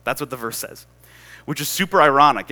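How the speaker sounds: ordinary delivery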